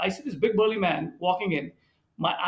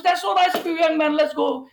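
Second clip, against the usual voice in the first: put-on much higher, louder, beaming old man; a grateful elder warmly accepting help; delighted old gentleman; 11 semitones higher, 6 dB louder than usual.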